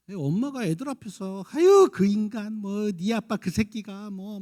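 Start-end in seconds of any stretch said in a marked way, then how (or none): 1.5-4.4 louder, higher, drawn-out mock complaint; exasperated grumbling. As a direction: act a wife griping about her husband. 엄마가 남편 험담하는 흉내. (남편 욕하며 푸념하는 말투)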